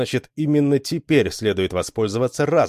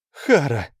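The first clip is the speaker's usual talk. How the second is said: startled, higher-pitched gasp; gasped name; 5 semitones higher than usual. A narrator's reading. Lita seized by the redhead, gasping. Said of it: "выдохнула она".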